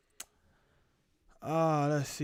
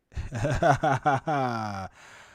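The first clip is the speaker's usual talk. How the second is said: big laugh; 2 semitones higher, 8 dB louder than usual. Real, not acted. Streamer cracking up at Reggie, big laugh. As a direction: burst out in a long, loud laugh.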